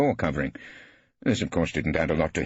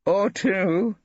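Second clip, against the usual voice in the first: cheeky, winking aside; sly Brittling; 5 dB louder than usual. A narrator's reading. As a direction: tease her with a wink.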